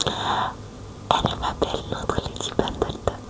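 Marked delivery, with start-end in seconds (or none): none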